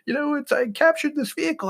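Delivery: very high-pitched mock panic; hammed-up frightened, protesting squeal; imitated shaken lieutenant. Shaken lieutenant protesting being fired at, imitated panic. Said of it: "shaking in his boots".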